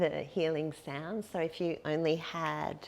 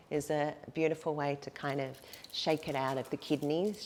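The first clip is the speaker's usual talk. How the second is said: ordinary talk, nothing marked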